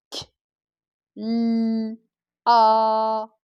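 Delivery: k, l, a pulled apart with pauses; sound by sound. Shown as a demonstration. Teacher repeating the syllable's sounds for pupils, sound by sound. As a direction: sound out k, l, a, holding l and a. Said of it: "je dois lire doucement".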